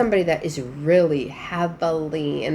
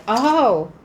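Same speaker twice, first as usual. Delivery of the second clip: higher, faster mock protest; a sarcastic jab, pitched up and hurried; mock indignant quote; 7 semitones higher, 4 dB louder than usual. Reader voicing the partner sneering at a replacement, mock indignant.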